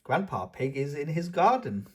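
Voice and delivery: ordinary delivery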